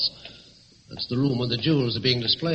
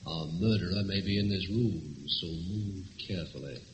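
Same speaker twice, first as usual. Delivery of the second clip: hushed; 7 semitones lower, 8 dB quieter than usual. Acted. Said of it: "Now, quiet".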